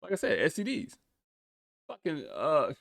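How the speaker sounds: incredulous, getting worked up; exasperated rant. Real, not acted. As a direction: pitch climbs high as the frustration builds. Ranting about vaccine priorities.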